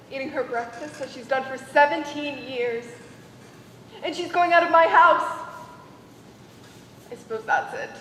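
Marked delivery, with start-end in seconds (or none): 4.0-5.5 upset outburst. Acted. Mother upset before wedding.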